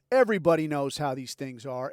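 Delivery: normal talking voice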